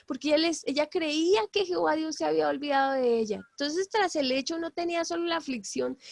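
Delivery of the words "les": ordinary talk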